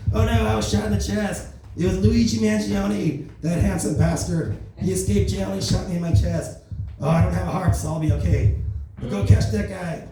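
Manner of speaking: a hint of Trump, playing the wounded president; Donald Trump impression. Trump tells the crowd he was shot, lightly voiced Trump. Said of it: "I gotta do the voice".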